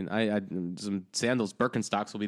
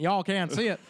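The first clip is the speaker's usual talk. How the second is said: put-on higher-pitched, playful Southern accent; 8 semitones higher than usual. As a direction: repeat it with a hammed-up Southern twang. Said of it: "I got Southern there for a second".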